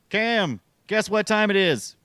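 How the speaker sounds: high-pitched game-show excitement; spring the big moment on them; gleeful announcing. Pretend. Host springs the closing segment on the guest, high gleeful announcing.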